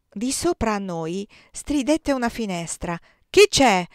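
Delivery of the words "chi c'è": loud, higher shout; shouted challenge. A narrator's reading. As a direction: call down sharply to an unknown intruder. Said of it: "gridò Stefano da sopra". (gridato dall'alto)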